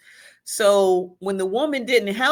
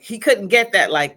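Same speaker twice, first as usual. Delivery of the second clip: normal talking voice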